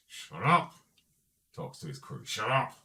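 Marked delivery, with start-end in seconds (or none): 0.1-0.7 higher, louder mock-angry order; sharp in-character telling-off; gang leader's rebuke. Leader silencing his own crew. 2.3-2.8 lightly acted loud order; mildly angry command to the crew; gang leader's rebuke. Leader silencing his own crew.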